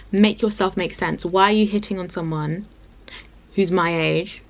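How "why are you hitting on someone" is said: ordinary delivery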